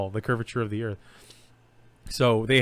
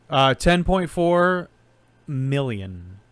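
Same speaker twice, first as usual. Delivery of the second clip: dramatic answer call; 4 semitones higher, 6 dB louder, much slower than usual. Acted.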